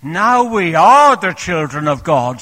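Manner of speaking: loud, lifted declaration; ringing proclamation. Acted. Preacher quoting John's epistle, loud and ringing. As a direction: proclaim it to the back of the church. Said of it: "says John in his epistle".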